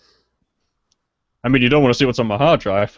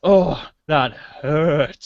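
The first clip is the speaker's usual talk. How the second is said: mock pained; 4 semitones higher, much slower than usual. Mock wounded reaction.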